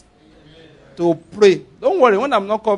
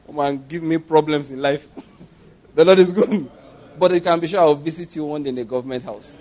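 ordinary delivery

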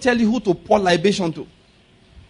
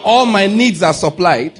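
slow and louder, each word pressed; preaching declaration; 5 dB louder, slower than usual. Shown as a demonstration. Preacher leads the congregation's declaration, slow and loud. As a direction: proclaim it for the church to repeat. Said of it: "Say it".

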